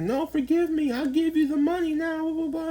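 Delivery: high squeaky mock plea; pleading ex. Mocking the ex who begs forgiveness, in a high squeaky whine. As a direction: whine it up high like a groveling ex begging.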